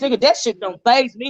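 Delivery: usual speaking voice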